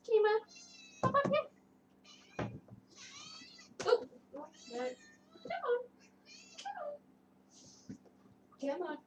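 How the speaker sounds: high sing-song call; calling the cat. Real, not acted. Owner calling her cat onto her lap, coaxing. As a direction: coax a cat up to you.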